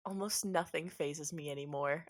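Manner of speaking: soft, understated woman's voice; smiling wife. Acted. Wife reassures her husband, softly and unruffled. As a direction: say it quietly through a small smile. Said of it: "She smiles and she says".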